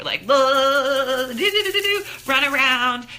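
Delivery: loud, high goofy babble to show shaking it out; silly dance noises. Shown as a demonstration. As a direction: blurt nonsense syllables like you're jiggling around. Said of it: "I want you to do that for thirty seconds".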